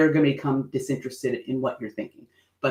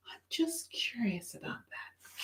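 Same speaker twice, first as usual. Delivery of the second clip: high, slow, hushed whisper; curious whisper; 8 semitones higher, 17 dB quieter than usual. Shown as a demonstration. Showing the curious face to listeners, whispered. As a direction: breathe it like a secret question.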